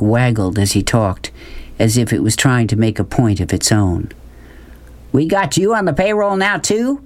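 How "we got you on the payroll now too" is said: put-on large man shouting a tease at a boy; shouted joke. A narrator's reading. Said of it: "Beauchamp shouted".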